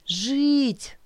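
high, long drawn-out plea. Acted. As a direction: stretch the word out, pleading. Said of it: "протянула я".

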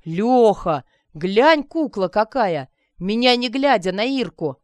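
loud, lively heckling; boisterous amused shout; merry onlooker. A narrator's reading. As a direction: call out gleefully from the crowd. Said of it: "Уже слышались весёлые голоса".

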